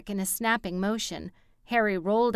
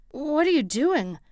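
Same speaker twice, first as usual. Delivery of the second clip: pained outcry; 7 semitones higher, 6 dB louder than usual. A narrator's reading. Harry hit by rope.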